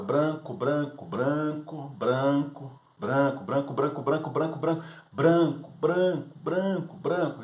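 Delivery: word repeated with rising and falling tune; sing-song the word up and down, stretching it; varying intonation. Shown as a demonstration. Teacher showing kids how to repeat the word with varied tune. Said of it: "você vai usar aí a entonação da voz, sobe, desce, desce, sobe, estica".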